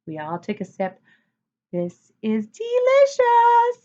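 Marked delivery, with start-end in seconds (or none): 1.7-3.8 high, slow, loud savoring delight. A narrator's reading. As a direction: stretch it out like the best taste ever. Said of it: "said Jessie".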